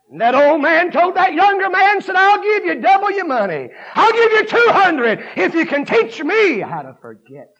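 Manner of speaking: high, shouted sermon building in pitch; full-force preaching. Real, not acted. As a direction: preach it loud, driving phrase after phrase.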